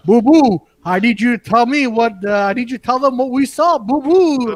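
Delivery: cartoon bear voice; Yogi Bear. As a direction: call out like a bossy cartoon bear. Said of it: "I just remember Yogi Bear always saying".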